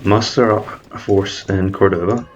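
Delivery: ordinary talk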